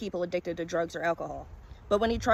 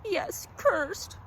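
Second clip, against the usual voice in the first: much higher, strained voice of a haunted man; 11 semitones higher than usual. A narrator's reading. Cursed collector insists to the stranger, high and haunted. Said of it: "he said".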